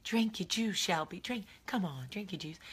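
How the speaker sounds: soft coaxing movie impression; movie role-play. Vlogger acting out Steel Magnolias, softly coaxing Shelby. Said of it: "I could do the whole movie".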